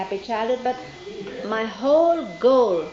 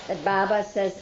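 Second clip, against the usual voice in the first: ordinary talk, nothing marked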